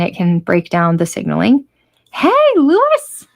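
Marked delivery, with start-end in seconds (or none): 2.1-3.3 a much higher, slow call across the room. Real, not acted. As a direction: call out to Lewis far away. Calling out to someone off-camera.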